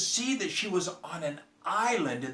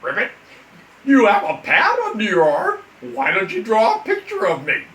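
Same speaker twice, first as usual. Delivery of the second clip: loud, higher, dramatic frog complaint; lamenting frog; 6 semitones higher, 11 dB louder than usual. Acted. Frog complaining to the girl, loud and dramatic. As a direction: wail it like a theatrical frog.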